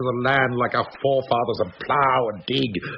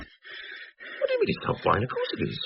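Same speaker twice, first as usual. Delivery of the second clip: stunned whisper; 3 semitones lower, 8 dB quieter than usual. Acted. Disbelieving whisper at table.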